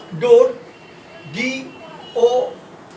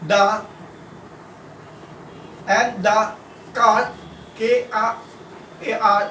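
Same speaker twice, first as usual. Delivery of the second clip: slow dictation; much slower than usual. Acted. Dictating sentences to a class.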